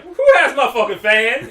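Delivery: loud, high comic outrage; mock angry demand. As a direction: yell it as the imagined wronged girl. Listener voices the furious girl.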